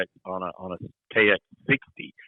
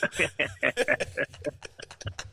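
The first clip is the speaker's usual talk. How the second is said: long, high, wheezy laugh; breathless laughter; 6 semitones higher, 6 dB quieter than usual. Real, not acted. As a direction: crack up at your own joke. Cracks up after yodel joke.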